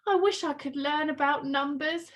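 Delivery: ordinary delivery, nothing marked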